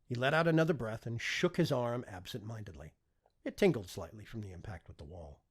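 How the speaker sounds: ordinary delivery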